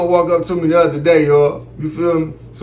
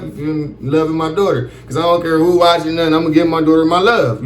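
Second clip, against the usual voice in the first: ordinary delivery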